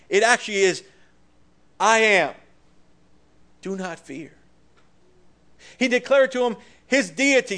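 full pulpit voice for the Lord's reassurance; preaching at full force. Acted. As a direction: declare it with fervour. Voicing Christ, preaching.

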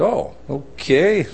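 hesitant, comically reluctant agreement; mock uneasy acceptance. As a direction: slowly pretend to take bad news in stride. Retells uneasy reaction to jail warning.

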